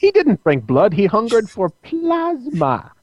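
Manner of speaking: Morbius voice. Retold or imitated. Morbius cartoon voice quote.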